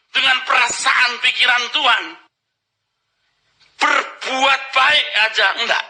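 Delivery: high, slow, ringing preacher's call; slow, lifted pulpit delivery; full-force preaching. Acted. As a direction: preach it out over the congregation.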